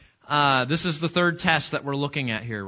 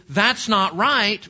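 slow, higher, louder objection; righteous protest; 5 semitones higher, 5 dB louder, slower than usual. Retold or imitated. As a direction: let the outrage rise. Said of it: "what rises up in our heart is".

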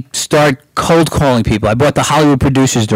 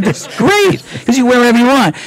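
eager reply; 9 semitones higher than usual. Retold or imitated. His own eager reply retold.

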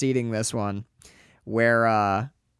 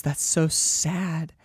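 mock childish girl; 4 semitones higher, much slower than usual. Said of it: "And she goes".

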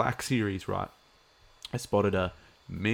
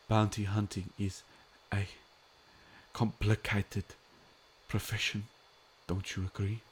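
Werner Herzog impression. Fan quoting Herzog's line to stream viewers, light German accent. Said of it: "what does he say?".

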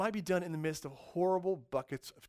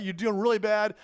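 usual speaking voice

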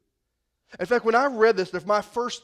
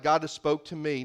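normal talking voice

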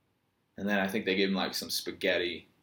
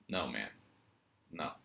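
normal talking voice

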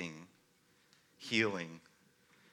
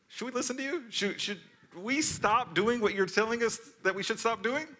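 sarcastic; 8 semitones higher than usual. Retold or imitated. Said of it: "quite sarcastic".

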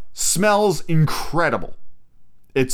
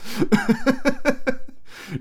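high-pitched giggling laugh; 13 semitones higher than usual. Real, not acted. Amused at the mouthwash and cough syrup comparison, high laugh.

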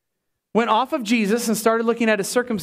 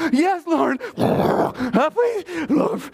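high, whiny begging voice; hammed-up humble pleading; pleading Peter; 7 semitones higher, much slower than usual. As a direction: beg like a scolded kid. Peter voiced begging after rebuke.

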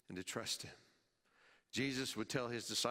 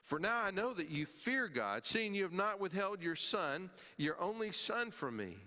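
raised, higher voice building on each phrase; fervent scripture reading; 8 semitones higher, 3 dB louder than usual. Acted. Preacher reading God's words to Abraham, raised and intense. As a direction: proclaim it with rising fervour.

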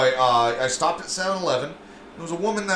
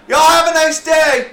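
much higher, louder sing-song farewell; cheery driver voice; 13 semitones higher, 10 dB louder than usual. Retold or imitated. Retelling the honking woman, high cheery goodbye. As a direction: chirp it like a carefree driver.

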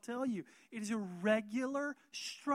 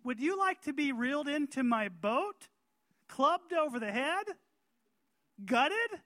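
mock fishing sign; 7 semitones higher, 9 dB louder than usual. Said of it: "a sign ... that says".

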